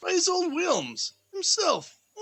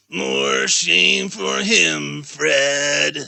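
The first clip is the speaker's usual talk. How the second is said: a lower, slower, louder wife's voice; low slow niece; 10 semitones lower, 7 dB louder, much slower than usual. Acted. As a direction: drawl it out as the scornful wife.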